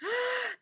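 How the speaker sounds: high surprised gasp; sharp gasp. Real, not acted. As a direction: gasp in shock at the page. Reader gasps at story.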